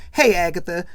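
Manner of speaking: loud joking call-out; being funny. Retold or imitated. Marcus teasing Angela with the wrong name, jokey shout. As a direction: call it out for laughs. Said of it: "being funny, of course".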